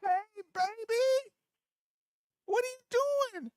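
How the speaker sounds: high, slow, sing-song greeting to a little grandchild. Real, not acted. Grandfather greeting his grandchild on the phone, high slow coo.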